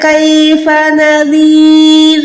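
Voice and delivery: slow, measured tajweed recitation, vowels held long; Quran recitation. Shown as a demonstration. Teacher models the verse for students, slow recitation. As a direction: chant the verse on a sustained even tone, very slowly. पूरी आयत कैसे पढ़ेंगे?.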